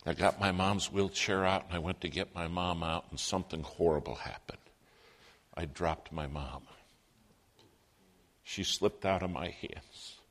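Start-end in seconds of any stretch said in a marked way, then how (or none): 3.1-10.2 hushed, lower, slowing down; grave. Real, not acted. Son telling how he dropped his mom, grave. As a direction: confess it softly and heavily.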